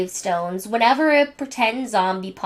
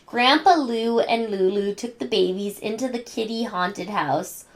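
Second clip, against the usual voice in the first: ordinary delivery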